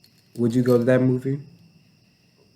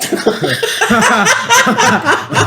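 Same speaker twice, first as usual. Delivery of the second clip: laughing apology; 8 dB louder than usual. Real, not acted. Bursts out laughing.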